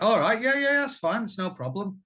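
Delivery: high, forced sing-song agreement; mock cheerful. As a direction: fake breezy compliance. Retold forced agreement to wife.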